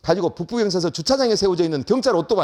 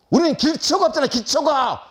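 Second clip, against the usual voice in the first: high, louder burst of indignation; angry student retort; 8 semitones higher, 3 dB louder than usual. Retold or imitated. Student protesting to the teacher about lacking basics, angry. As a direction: act out a student snapping back, pitch jumping up. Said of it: "이게 왜 나한테 화를 내?".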